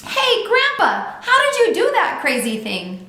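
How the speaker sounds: put-on high, loud child's holler. A narrator's reading. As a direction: call out to Grandpa, loud and high. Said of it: "I hollered".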